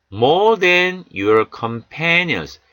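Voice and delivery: each word drawn out slowly; very slow model reading. Shown as a demonstration. Teacher reading a psalm verse slowly for students. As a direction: read it slowly for learners to copy. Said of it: "Words and pronunciation".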